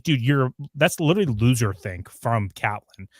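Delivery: ordinary delivery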